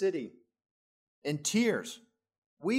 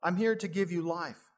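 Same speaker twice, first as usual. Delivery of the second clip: mild mock cry out; 6 semitones higher than usual. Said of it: "always crying out and screaming out".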